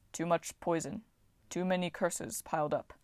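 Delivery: soft, grave Titan; lightly voiced sorrowful giant. A narrator's reading. Voices Bob.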